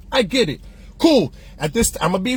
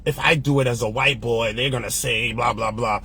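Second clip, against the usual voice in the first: quoting Rodigan; 11 semitones lower, 4 dB quieter than usual. Retold or imitated. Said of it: "I'ma quote Rod again".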